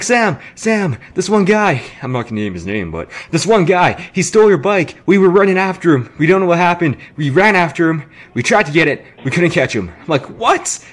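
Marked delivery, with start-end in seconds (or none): 0.0-1.8 excited friend. Retold or imitated. Friend [name] runs up to tell [name] his bike was stolen, high and urgent. 3.3-9.9 excited friend. Retold or imitated. Friend [name] runs up to tell [name] his bike was stolen, high and urgent. 10.4-10.8 sharply rising, very high disbelief; shocked what. Retold or imitated. Sam hears his bike was stolen, sharp high shocked rise.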